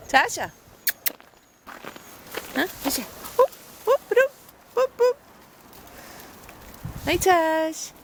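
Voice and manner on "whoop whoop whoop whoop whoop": high playful hooting to the dog; high whoop calls. Real, not acted. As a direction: call out high, sing-song whoops.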